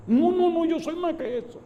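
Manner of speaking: very high, strained contradiction; imitating a man protesting in a squeaked voice; agitated insistence. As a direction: insist it, pitched far up. Retold sinner insists he is worse.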